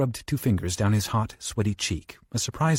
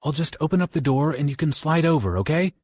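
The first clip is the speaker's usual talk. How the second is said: raised voice, calling through a wall; calling over; 6 semitones higher than usual. Acted. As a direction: call out to a friend in the next room. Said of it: "Johnny called over".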